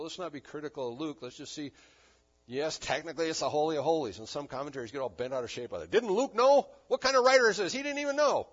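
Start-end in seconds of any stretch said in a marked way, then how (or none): 5.9-8.5 loud, high, outraged questioning; mock an affronted commentator, voice pitched way up; indignant critic. Mimics indignant commentators bent out of shape.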